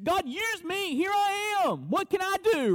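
high, building, eager plea to be used; eager believer. Acted. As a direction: burst with zeal to serve. Forgiven believer voiced volunteering.

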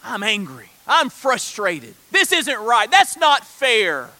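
acted indignant grievance. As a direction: complain with building volume and frustration. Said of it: "Is it indignation".

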